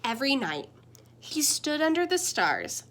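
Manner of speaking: usual speaking voice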